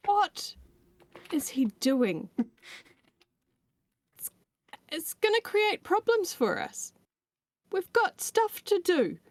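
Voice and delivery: sharp, high exasperated scolding; annoyed scolding. Acted. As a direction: snap at him, fed up. [name] scolds Thoricus in character.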